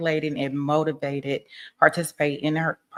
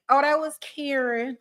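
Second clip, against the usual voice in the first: mock defendant excuse; 8 semitones higher than usual. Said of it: "I was waiting on her to say".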